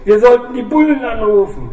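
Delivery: high, loud frightened plea; desperate, near breaking; panicked. A narrator's reading. Wounded biker urging police call, high and loud.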